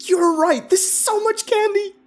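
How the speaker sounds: very high, loud excited squeal; shrieky little-kid excitement. A narrator's reading. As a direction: squeal with delight over a haul of candy. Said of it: "she squealed".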